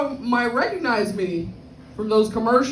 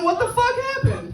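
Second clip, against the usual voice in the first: high incredulous squeal; mock outrage; 13 semitones higher than usual. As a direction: voice jumping much higher in disbelief. Mock betrayal at community.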